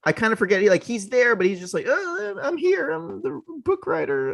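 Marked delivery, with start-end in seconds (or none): 1.9-4.3 high, slow, dopey mock voice; mock dopey Elijah Wood. Co-host mocks the overshadowed lead in a high dopey voice. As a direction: play him as a dim, forgettable hero. Mocking Elijah Wood's bland character.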